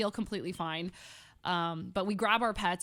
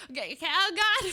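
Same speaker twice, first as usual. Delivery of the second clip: high, louder mock panic; hammed-up flustered outburst; freaking out; 9 semitones higher, 5 dB louder than usual. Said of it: "So I'm freaking out".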